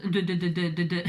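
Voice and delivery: tripping over "de" repeatedly; real stutter, stuck on one small word. Real, not acted. Crafter stumbling mid-explanation to viewers, stuttering. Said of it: "je bégaye".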